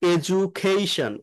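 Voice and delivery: slowly, with the d said as j; drawn-out teaching demo of এডুকেশন; slow model word. Shown as a demonstration. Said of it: "এই ডিউটা জ উচ্চারণ হয়".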